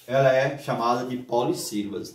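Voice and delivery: ordinary talk, nothing marked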